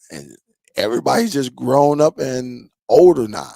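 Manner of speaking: usual speaking voice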